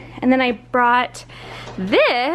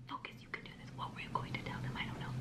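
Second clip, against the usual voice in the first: whispering; 26 dB quieter, much faster than usual. Real, not acted. Pep-talking herself under breath.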